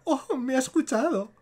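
high-pitched mock gasp of being overheard; imitated startled realisation. She realises he heard her confession, imitated high startled reaction. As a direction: put on her surprised little "oh". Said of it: "Y la otra".

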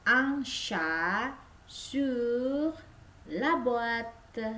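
slow French demo; very slow model. Shown as a demonstration. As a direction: drag the sentence very slowly. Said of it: "Contoh kalimatnya".

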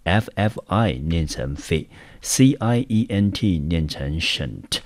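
slow, careful syllable by syllable reading; deliberate classroom spelling-out. Shown as a demonstration. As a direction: spell out fi and cient slowly, like a teacher. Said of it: "念成fi ... 念成cient".

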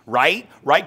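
needy right right; mock filler rights. Shown as a demonstration. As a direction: plead for agreement with each right. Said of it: "can you please agree with me".

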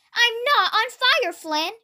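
high, put-on complaining voice; indignant grumble. A narrator's reading. Soaked Gordon scolds Flynn, grumbling in high protest. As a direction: grumble in protest, dropping away on the name. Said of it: "Gordon grumbled".

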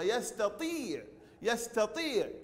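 يستطيع with tii held long; saturated long ii. Shown as a demonstration. Teacher modelling the answer, long ii. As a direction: stretch the vowel after the taa in يستطيع. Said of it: "نشبع حرف الطاء بالكسرة".

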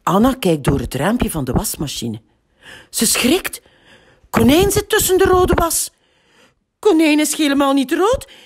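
ordinary delivery

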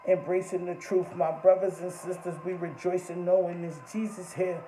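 ordinary talk

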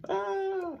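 high yell, full voice. Shown as a demonstration. Teacher shows kids how to yell. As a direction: let it ring out loud. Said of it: "Yell to make your voice loud".

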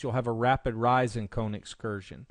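ordinary talk

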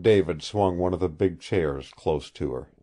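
usual speaking voice

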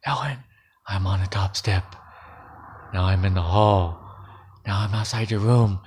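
low, soft, creeping menace; eerie whisper. A narrator's reading. Strange voice tells Ellen it is nearing her room, eerie whisper. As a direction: draw nearer in a hushed low voice. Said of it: "Then the voice whispered".

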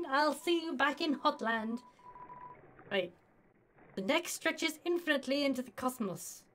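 ordinary talk, nothing marked

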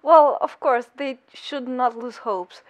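ordinary talk, nothing marked

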